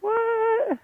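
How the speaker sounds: a high squeaky what; surprised what. Retold or imitated. Pilot recalling his shocked reaction to his buddy. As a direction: pitch the question way up in disbelief. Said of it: "I was like".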